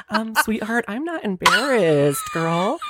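high, drawn-out mock sweetness; mock condescending. Driver to the naked graduate, mock condescending. Playing the driver answering her.

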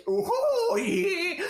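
put-on high whiny baby noise. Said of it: "that sort of whiny baby voice".

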